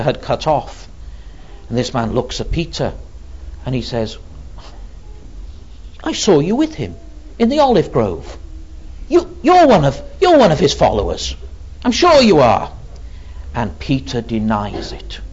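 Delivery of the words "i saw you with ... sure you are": higher, insistent accusation; accusing servant. Retold or imitated. High priest's servant accusing Peter of following Jesus, insistent.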